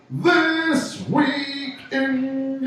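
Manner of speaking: high, drawn-out segment announcement; hyped-up host holding the last word long; show announcer. Pretend. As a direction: stretch the title out like a ring announcer.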